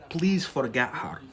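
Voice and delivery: ordinary delivery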